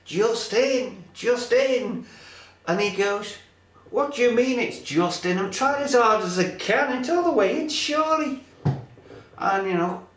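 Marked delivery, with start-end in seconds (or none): 0.0-2.1 mock calling out, a little louder and higher; higher mock shout, not full volume; retold shouting. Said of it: "she goes and shouts". 3.9-8.4 higher, louder mock indignation; climbing, exasperated comic retort; exasperated protest. Acted exasperated boyfriend answering her.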